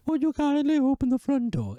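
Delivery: very high, loud mock-female voice; bad woman's voice. Man failing at her voice, comically high woman impression. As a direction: badly fake a woman, squeaky high and loud. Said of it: "She does it in her voice. I can't do her voice".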